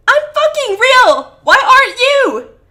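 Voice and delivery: high, drawn-out scream of accusation; accusing scream. A narrator's reading. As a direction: scream your accusation at the sky. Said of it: "He points towards the sky accusingly".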